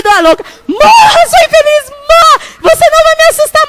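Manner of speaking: high, loud shouted curses broken by laughter; shouting with laughter. Retold or imitated. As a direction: yell at the frog while laughing. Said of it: "eu gritava".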